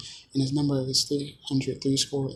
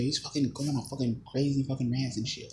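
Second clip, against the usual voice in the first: ordinary delivery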